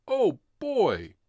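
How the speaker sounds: high, loud burst of glee; excited oh boy. A narrator's reading. Narrator sharing the kids' party joy, loud and high. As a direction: squeal it like a thrilled kid.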